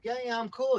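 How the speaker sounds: high, sly voice for the other dog; mock the dog playing innocent; imitating the dog. Voicing the pit bull pretending to be friendly, sly and high. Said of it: "He's like".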